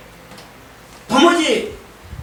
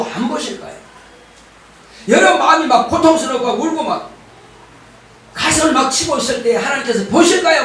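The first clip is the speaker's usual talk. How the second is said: usual speaking voice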